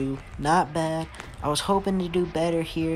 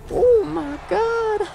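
much higher, drawn-out gasp of disbelief; stunned amazement; 4 dB louder, much slower than usual. Real, not acted. Gamer watching his wild spinning kick land, stunned. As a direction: squeal it out, can't believe it landed.

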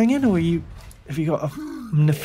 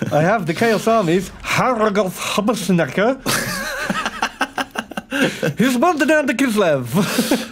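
put-on French-accented reporter, lightly done; a hint of a French war reporter; Lesnok the war correspondent; 4 semitones higher than usual. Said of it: "Lesnok's war correspondent's voice".

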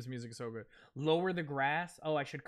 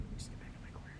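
whispering; 10 dB quieter, much faster than usual. Real, not acted. Sneaking in game.

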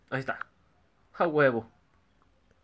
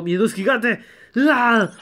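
loud startled cry; surprised yelp; 7 semitones higher, 8 dB louder than usual. Real, not acted. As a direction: yelp at the sight. Shocked by filter.

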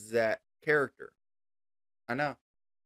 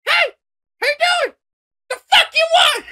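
much higher, much louder furious yell; Jimmy's angry shout; 14 dB louder than usual. Shown as a demonstration. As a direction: bark the greeting and then blow up. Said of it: "And now it's".

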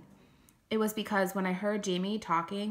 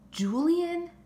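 high, louder incredulous question; dismayed surprise; 11 semitones higher, 6 dB louder than usual. Acted. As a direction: echo the name in disbelief, pitched way up. Dismayed reaction to hearing the name.

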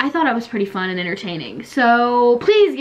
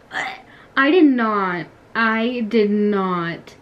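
slow emphatic denial; much slower than usual. Real, not acted. Denying to fellow players.

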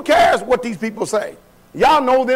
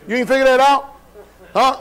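ordinary talk

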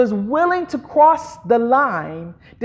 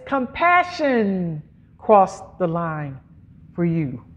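slow, lowered delivery, easing down at the end; deliberate restatement; 6 semitones lower, 4 dB quieter, slower than usual. Real, not acted. Preacher rephrasing the point for the congregation, slow and deliberate. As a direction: draw it out slowly and let it settle. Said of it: "can I say it like this".

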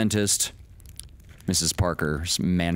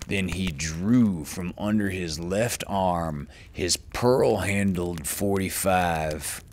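usual speaking voice

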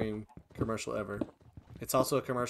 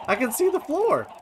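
high, loud delighted cry; loud, high and thrilled; excited discovery; 14 semitones higher, 6 dB louder than usual. Real, not acted. Delighted at fox's progress.

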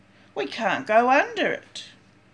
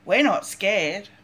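ordinary delivery

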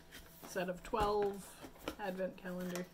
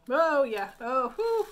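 surprised; 5 semitones higher, 14 dB louder, slower than usual. Real, not acted. Strong peppermint smell hits.

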